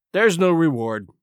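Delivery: a mildly brusque cut-in; lightly voiced curt sergeant; short interruption. A narrator's reading. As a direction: cut him off curtly. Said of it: "interrupted the sergeant shortly".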